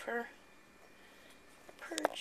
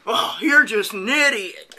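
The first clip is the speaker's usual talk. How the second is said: mocking voice; 12 dB louder, slower than usual. Mocking a skeptic commenter.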